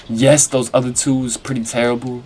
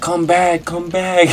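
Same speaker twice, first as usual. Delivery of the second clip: higher pleading call; calling after her; 6 semitones higher, slower than usual. Retold or imitated. Begging his girlfriend not to run out, calling. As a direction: call her back as she runs.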